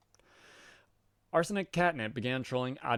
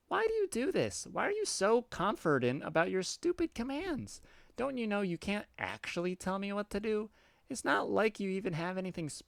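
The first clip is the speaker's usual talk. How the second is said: put-on much higher, childish defiant cat girl; 11 semitones higher than usual. Cat girl refusing the troll's order, high and childish. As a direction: squeak back like a defiant kitten.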